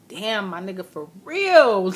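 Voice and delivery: replayed shock, erupting on the last words; shocked reaction. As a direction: build to an astonished, loud exclamation. Acts out own shocked reaction.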